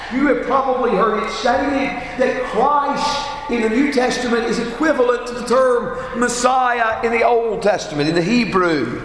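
ordinary delivery

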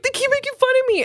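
put-on high, fast whiny kid; tattling kid. Kid tattling to his mom, high fast whine. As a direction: rush it out high like a tattling child. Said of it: "telling his mom".

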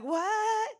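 shocked Jamaican mom, high pitch; high shocked cry in Jamaican accent. Retold or imitated. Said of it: "Just with her Jamaican accent".

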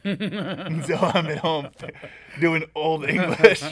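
laughing slowly through the words; can barely get it out for laughing. Real, not acted. Telling his co-host the story while laughing slowly.

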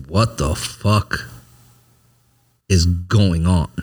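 very slow, drawn-out self-questioning; retold dazed realization, long pause midway; stunned disbelief. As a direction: stretch each word out as it sinks in. Retold stunned realization after he left.

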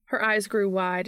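usual speaking voice

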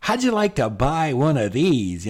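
mock cheery pop-up ad; pop-up window voice. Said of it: "a little window comes up and says".

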